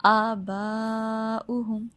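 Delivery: slow chanted "آباؤہم" with the second aa held long; long madd. Shown as a demonstration. Teacher modelling "آباؤہم", long sustained madd. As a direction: stretch "با" in "آباؤہم" well out. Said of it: "چار یا پانچ second لمبا".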